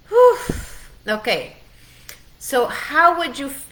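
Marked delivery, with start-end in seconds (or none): 0.1-0.4 high, loud exhale of relief; sharp high whew of release; big relieved whew. Real, not acted. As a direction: blow out the emotion with a loud whew. Shaking off emotion.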